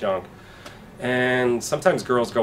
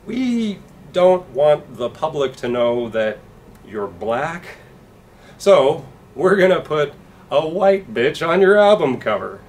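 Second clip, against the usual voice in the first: slick record executive, pitched up at times; 3 semitones higher, 4 dB louder than usual. Retold or imitated. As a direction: talk like a smarmy fifties record man. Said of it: "they'd be like".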